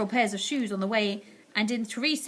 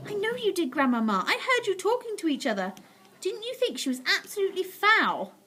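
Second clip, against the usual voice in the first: put-on higher boyish voice; chatty and eager; 9 semitones higher than usual. A narrator's reading. Boy asking grandmother about the witch.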